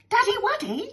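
put-on very high, babyish child voice; little boy. Son calls to his father, high babyish voice. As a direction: pipe up sweetly for daddy.

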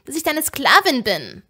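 loud, high indignant cry; angry outburst. A narrator's reading. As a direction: throw it at him, loud and outraged. Heated refusal to Tom in story. (wütend und empört herausgerufen)

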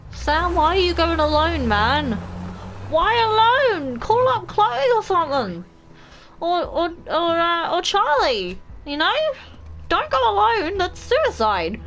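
high, loud, urgent protest; worried pleading. Real, not acted. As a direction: plead in a rising, anxious pitch. Pleads with game character not to go.

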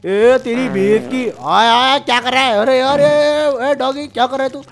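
shrill yelps as he slips; high panicked cries. Acted. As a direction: cry out while slipping and falling. Slipping on dog mess, crying out.

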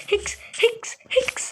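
high, spelt-out fake sobs; mock sobbing. Mourning the panda with high, hammed-up fake sobs. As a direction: sob in a hammed-up way.

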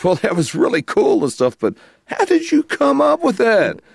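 high-pitched imitation climbing to an incredulous question; baffled wife. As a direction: voice a wife's bafflement, soaring at the end. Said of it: "She goes".

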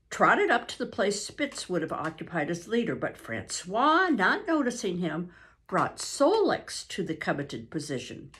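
plain reading voice

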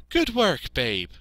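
high, loud start dropping down; praising sheepdog. A narrator's reading. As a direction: praise the pupil emphatically. Voices Fly the dog.

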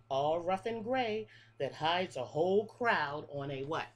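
lilting picture-book read-aloud, each line rising then falling; reading to children. Acted. Teacher reading a bug rhyme book to her class, sing-song. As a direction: read the rhyme like story time for little kids.

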